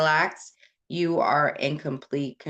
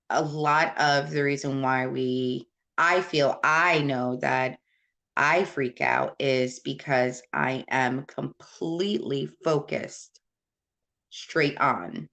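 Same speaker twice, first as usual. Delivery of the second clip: ordinary talk, nothing marked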